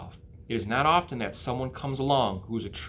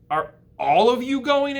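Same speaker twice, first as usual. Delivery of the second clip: higher, louder worried plea; anxious pig voice; 13 semitones higher, 8 dB louder than usual. Acted. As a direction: ask like a scared little pig. Wilbur pleading.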